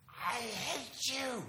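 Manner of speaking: high, drawn-out mock tantrum yell; screaming kid. Preacher imitating bratty boy yelling at mom, screaming kid. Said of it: "he's screaming and yelling at his mom".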